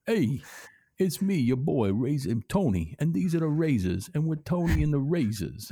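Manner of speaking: mild goomba character. Retold or imitated. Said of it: "something like".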